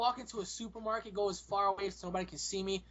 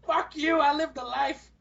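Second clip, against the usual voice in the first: loud, higher cranky geezer retort; hammed-up old-timer outburst; yelling old man; 6 semitones higher, 5 dB louder, slower than usual. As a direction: snap back like an indignant old man. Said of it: "I would yell at everybody".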